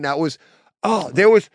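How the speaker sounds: normal talking voice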